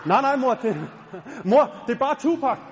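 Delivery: laughing embarrassed son. Retold or imitated. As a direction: brush mom off, giggling.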